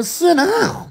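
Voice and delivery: sudden high-pitched squeal; yelping at a crane fly to sit down; high yelp at bug. Real, not acted. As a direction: shriek the order at the bug.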